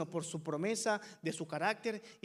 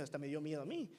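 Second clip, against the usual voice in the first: normal talking voice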